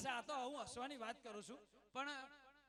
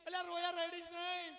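mock scolding; 4 semitones higher, 5 dB louder than usual. Old women scolding the mourner.

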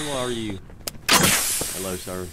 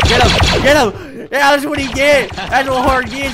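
high, loud gunfight hollering; loud, raised-pitch taunting; excited battle shouting; 6 semitones higher, 9 dB louder than usual. Real, not acted. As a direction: yell it in the heat of a shootout.

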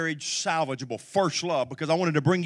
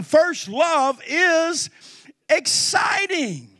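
slow emphasis; 12 semitones higher, 6 dB louder, much slower than usual. Acted. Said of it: "slow emphatic preaching".

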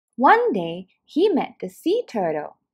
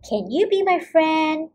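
hopeful little fish voice; 5 semitones higher than usual. Acted. Fish asks dolphin.

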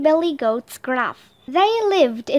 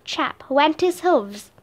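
ordinary delivery, nothing marked

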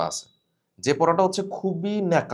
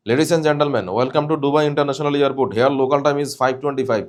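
announcement in a loud Indian accent; 5 dB louder, much slower than usual. Shown as a demonstration. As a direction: read it out with an Indian English accent. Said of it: "This is the Indian accent". (ভারতীয় উচ্চারণে ঘোষণা)

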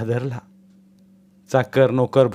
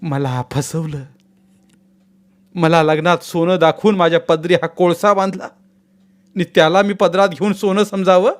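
anguished lament; 7 semitones higher, 4 dB louder than usual. A narrator's reading. Deceived bride lamenting to herself, anguished. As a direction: pour out her despair. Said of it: "आकांत करू लागली".

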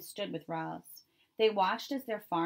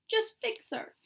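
blurted out. A narrator's reading. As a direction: blurt it out as eager kids. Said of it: "the children blurted out".

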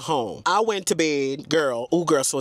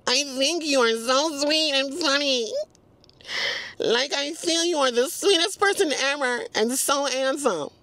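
sweet Southern Belle; 6 semitones higher than usual. Retold or imitated. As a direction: read the message like a polite Southern lady. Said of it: "Southern Belle said".

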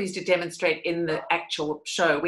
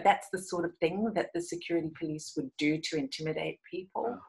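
ordinary talk, nothing marked

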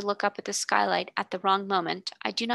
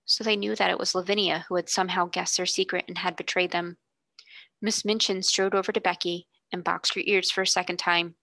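usual speaking voice

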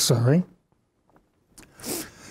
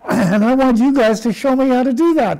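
laughing while asking; 8 semitones higher, 4 dB louder than usual. Pretend. Pretend Shakespeare laughs mid-request.

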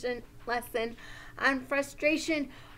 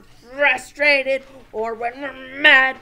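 loud mock-frustrated bursts; acted anger; 13 dB louder than usual. Teacher telling friends when to practise, hammed-up anger. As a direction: hit the feelings with hammed-up anger. Acts out each feeling named.

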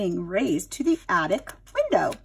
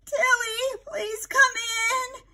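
high, very slow coaxing plea; pleading aunt; 14 semitones higher, much slower than usual. Acted. Aunt Sarah coaxing Tilly back inside, high slow plea. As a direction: beg the bird to come inside.

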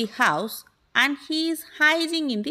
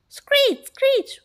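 very high-pitched squeal; possum screech; 14 semitones higher, 5 dB louder than usual. A narrator's reading. Possum running off through the house, high screech. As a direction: screech like a startled little animal. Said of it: "went the possum".

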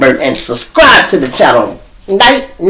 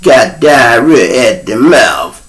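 slow, stretched-out accent impression; [name]'s accent; 2 semitones lower, slower than usual. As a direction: put on his accent and drag out every phrase. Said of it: "Y'all hear my accent?".

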